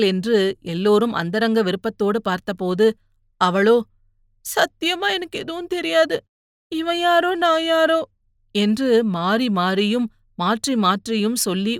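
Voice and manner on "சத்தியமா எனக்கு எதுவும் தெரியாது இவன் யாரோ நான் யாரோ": high, thin crying voice; tearful. A narrator's reading. Young woman denying she knows him, tearful. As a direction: plead innocence through tears. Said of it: "ஒவ்வொரு சொல்லுக்கும் ஒவ்வொரு விதமாய் அழுதாள்".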